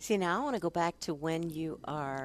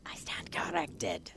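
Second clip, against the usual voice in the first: whispered; 10 semitones lower than usual. Real, not acted. Conceding after a correction.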